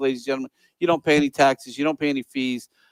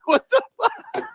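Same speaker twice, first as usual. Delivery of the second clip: much higher, laughing disbelief; the girlfriend at the diner, higher laughing disbelief; shocked girlfriend; slower than usual. Retold or imitated. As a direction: squeal it in disbelief through a laugh. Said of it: "And she's like".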